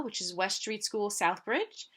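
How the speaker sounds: plain reading voice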